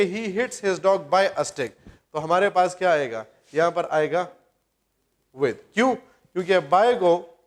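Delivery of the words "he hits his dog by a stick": very slow, word by word; dictated slowly. Shown as a demonstration. Teacher dictating the last quiz question to students, very slowly. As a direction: read out the question slowly so they can write it. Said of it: "آج کا M C Qs ہے".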